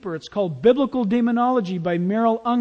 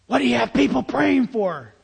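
imitated demon speaking through a man, rough and strained; demonic voice; 2 semitones higher than usual. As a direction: mimic a possessed man's hellish voice. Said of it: "It was a voice from hell itself".

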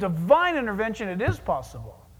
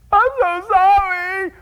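loud, higher, slow sorry; heartfelt apology; 9 semitones higher, 10 dB louder, slower than usual. Acted. Sinner repents before God, loud heartfelt apology. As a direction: cry out the apology.

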